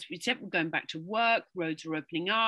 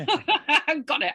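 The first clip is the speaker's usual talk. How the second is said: laughing it off, higher and louder; 7 semitones higher, 8 dB louder, slower than usual. Real, not acted. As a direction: chuckle through the words. Laughing at her own throat.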